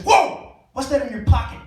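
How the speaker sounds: loud, high "Whoa!" then a sharp question; suddenly alarmed officer; startled cop. Retold or imitated. Cop spots something suspicious.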